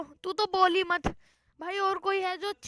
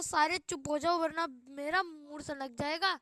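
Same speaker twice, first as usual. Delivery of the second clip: ordinary talk, nothing marked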